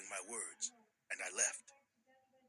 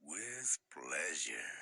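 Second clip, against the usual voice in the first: creepy low voice; 10 semitones lower, much slower than usual. Acted.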